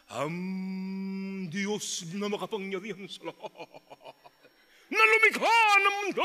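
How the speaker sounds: hammed-up lofty prayer voice, rising to a loud finish; lightly voiced pompous prayer; showy pious prayer. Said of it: "Ang galing manalangin".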